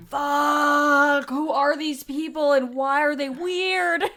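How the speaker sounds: loud, high, baffled listener voice; bewildered outburst. Retold or imitated. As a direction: blurt it out in shocked disbelief. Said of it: "They're like".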